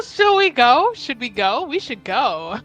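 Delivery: ordinary talk, nothing marked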